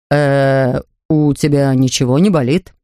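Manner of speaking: a touch of alarm; anxious Mr Brown. Acted. Father asks the bear if he is ill, mildly anxious.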